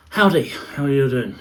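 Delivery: loud cheery greeting. Real, not acted. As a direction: call out a big hello.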